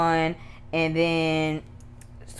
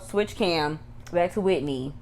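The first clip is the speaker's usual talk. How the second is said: ordinary delivery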